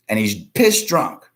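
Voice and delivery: ordinary delivery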